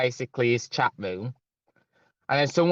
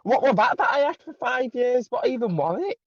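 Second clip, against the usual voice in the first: incredulous; 9 semitones higher than usual. Retold or imitated. Outraged inner voice.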